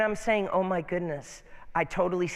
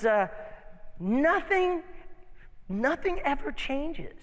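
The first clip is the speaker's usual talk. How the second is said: much higher, drawn-out sighing whine; whiny butterfly; 8 semitones higher, slower than usual. Acted. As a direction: moan it like a bored little butterfly. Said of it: "He, He goes".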